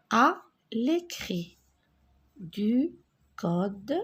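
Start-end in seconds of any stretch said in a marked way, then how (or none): none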